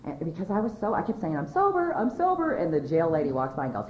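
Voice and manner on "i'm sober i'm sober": very high mock protest; insistent whine. Jailed woman to anyone passing, high-pitched insistent protest. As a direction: keep insisting in a high, pitiful voice. Retold insistent refrain in jail.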